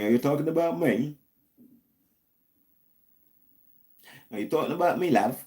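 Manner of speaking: playing at a Londoner, teasing; mock British accent. Mimicking a Brit on his live stream, mock British accent. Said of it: "I'm trying to talk British language".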